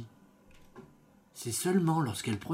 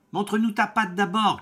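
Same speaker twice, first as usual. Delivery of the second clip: put-on high, louder children's demand; kids crying out; 8 semitones higher, 5 dB louder than usual. Goat kids shouting to the wolf, high and louder. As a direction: call out like wary little goats. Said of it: "crièrent les chevreaux".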